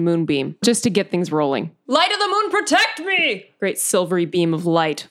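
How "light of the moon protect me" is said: spell invocation. Acted. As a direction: call on the moon for protection.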